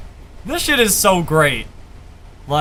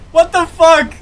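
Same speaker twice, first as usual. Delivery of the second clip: stunned outburst; 7 semitones higher, 6 dB louder than usual. Real, not acted. Stunned reaction to the verse.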